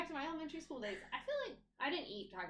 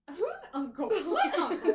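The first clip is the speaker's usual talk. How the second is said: put-on baffled child; 7 semitones higher, 10 dB louder, slower than usual. Child's baffled reaction imitated.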